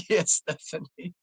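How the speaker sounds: ordinary talk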